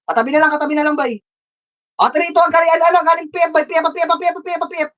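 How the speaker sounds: high-pitched excited battle orders; frantic high shotcalling. Real, not acted. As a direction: drive the team through the fight. Calling final push.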